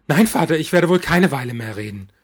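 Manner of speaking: loud, shrill outburst; hysterical. Acted. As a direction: lose it at the father. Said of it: "Mein Ton wird hysterisch".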